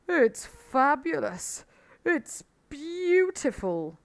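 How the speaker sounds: high, loud, drawn-out rapture; overcome, fighting for breath. A narrator's reading. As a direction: stretch each word in mock breathless awe. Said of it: "fighting for breath".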